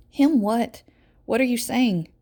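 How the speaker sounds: bewildered mother demanding answer; urgent alarm. A narrator's reading. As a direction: press him, alarmed.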